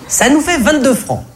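voix de caissière haut perchée; vendeuse qui glapit. Acted. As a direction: annoncer le prix en glapissant. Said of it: "qu'est-ce qu'elle glapit-elle". (caissière glapissante, très aiguë)

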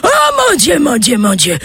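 put-on high, wailing old man cries; hammed-up howling. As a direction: cry out over and over in mock ecstasy. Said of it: "il se met à hurler".

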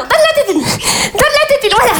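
put-on high-pitched baby-soothing voice; coaxing frazzled mother. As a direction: coax the baby, barely holding together. Mother coaxes baby with dummy.

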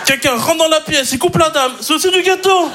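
high-pitched comic disbelief; mock amazement. Watching everything get cut into cake, mock amazement.

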